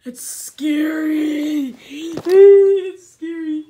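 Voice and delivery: milking the scare, slowly; drawn-out scared repeat. Real, not acted. Gamer stretching out his fright, slowly.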